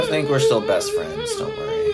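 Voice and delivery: very high, loud hammed-up sobbing; mock crying. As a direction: wail it like a crybaby.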